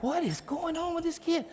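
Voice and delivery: high, bewildered exclaim; baffled. Retold or imitated. Parents puzzled by the boy's walk, high baffled exclaim. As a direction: ask it in total disbelief.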